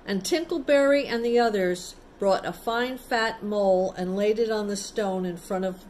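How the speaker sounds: usual speaking voice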